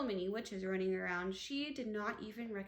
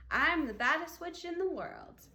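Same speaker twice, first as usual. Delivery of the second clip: loud, bouncy witch boast; muffled gleeful witch; 5 semitones higher, 6 dB louder than usual. A narrator's reading. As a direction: skip through it like a proud little witch. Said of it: "her muffled voice skipping".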